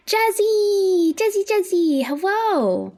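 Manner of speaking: high sing-song call to a pet. Real, not acted.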